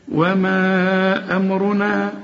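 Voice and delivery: slow recitation with long held vowels in وما أمرنا; each letter its due. Shown as a demonstration. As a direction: draw out وما and keep أمرنا light at the start. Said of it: "ما ادي كل حرف حقه".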